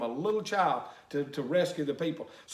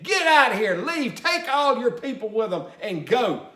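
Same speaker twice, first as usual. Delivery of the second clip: shouted order, loud and high; furious Pharaoh; 3 semitones higher, 4 dB louder than usual. Retold or imitated. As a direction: bark the command like an angry king.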